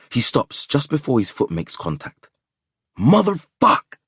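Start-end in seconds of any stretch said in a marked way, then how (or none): 3.0-4.0 high, loud frustrated curse; angry curse. A narrator's reading. Stranded driver cursing his broken car, furious. Car breaks down, kicking bumper.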